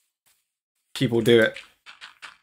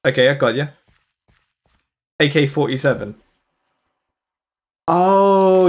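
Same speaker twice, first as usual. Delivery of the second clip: usual speaking voice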